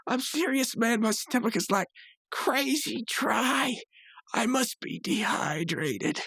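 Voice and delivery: very high strained mock suffering; slow, dragged-out fake agony; Rigby. Rigby keeps faking illness, retold.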